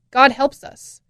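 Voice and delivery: ordinary talk, nothing marked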